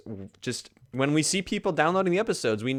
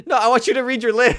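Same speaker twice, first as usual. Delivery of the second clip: raised, pushing the point; insistent; 11 semitones higher, 9 dB louder than usual. Real, not acted. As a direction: stress on "you" in "I want you to read your list", loud and high. Host insists co-host reads list.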